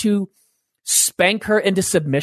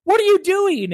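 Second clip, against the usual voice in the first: much higher, pointed questioning wife; the wife calling him out, voice raised high; wife challenging; 12 semitones higher than usual. Retold or imitated. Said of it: "She goes".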